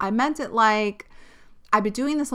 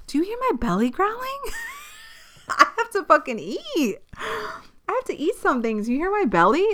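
laughing; 11 semitones higher than usual. Real, not acted. Laughing at her growling belly.

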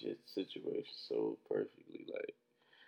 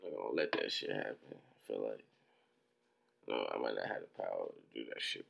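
high and drowsy delivery; stoned; 12 semitones lower, 4 dB quieter than usual. Real, not acted. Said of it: "high and tired".

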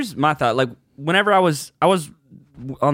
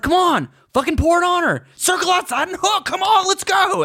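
high hyped-up corner coach; coaching yell. Retold or imitated. Cornerman hyping his fighter, high yelling coach voice. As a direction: egg on a fighter from the corner, pitched way up. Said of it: "I'm like".

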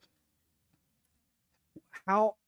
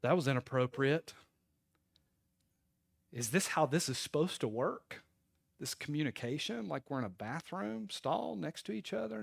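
hushed, uneasy questioning; awkward muttering; 9 dB quieter than usual. Retold or imitated. Person praying to God as if in next stall, softly awkward. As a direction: drop the volume, mock-uneasy. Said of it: "and you're like".

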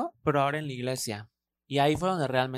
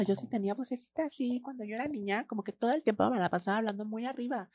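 lightly raised little voice; high childhood voice; 7 semitones higher, 6 dB quieter than usual. Shown as a demonstration. Demonstrating her childhood voice, higher. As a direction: show how high she talked as a girl. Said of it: "una vocecita muy arriba".

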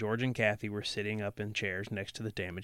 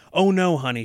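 higher, louder wifely reassurance; protesting; 6 semitones higher, 10 dB louder than usual. A narrator's reading. Wife assuring her dazed husband, mildly protesting. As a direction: protest quickly and emphatically. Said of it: "she protested".

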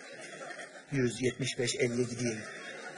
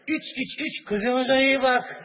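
solemn father; 7 semitones higher, 5 dB louder, much slower than usual. Retold or imitated. Bride's father at wedding.

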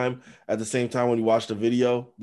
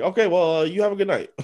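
flustered cop; 7 semitones higher, 6 dB louder than usual. Retold or imitated. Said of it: "And he's like".

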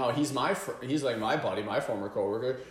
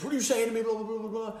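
mock aggressive confrontation; 11 semitones higher, faster than usual. Drunk confronting the buddy, high mock aggression. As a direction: get in his face, belligerent. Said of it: "He gets on his face".

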